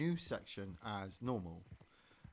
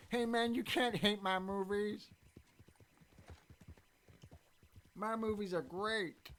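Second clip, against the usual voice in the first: high, slow whiny celebrity voice; Adam Sandler; 10 semitones higher, 4 dB louder, slower than usual. Retold or imitated. Comedian defending his movies to critics, high slow whine. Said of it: "Adam Sandler's like".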